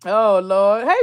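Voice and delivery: usual speaking voice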